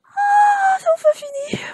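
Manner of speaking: very high wailing cry of relief; long high wail easing off; hammed-up relief. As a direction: squeal out that it's finally over. Long wait in game finally over.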